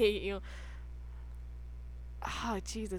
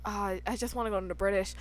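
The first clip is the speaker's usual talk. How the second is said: ordinary talk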